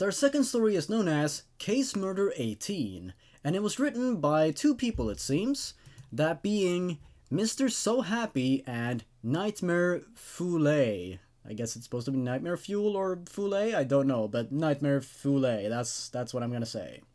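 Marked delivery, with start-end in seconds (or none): none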